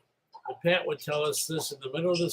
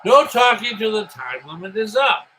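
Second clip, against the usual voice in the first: called out loud, firm and high; loud announcement; 5 semitones higher, 9 dB louder than usual. Retold or imitated. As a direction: announce the rule over the crowd. Quoting the rule again.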